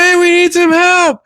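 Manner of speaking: very high mock shout; calling for help. Imitates movie characters calling for help.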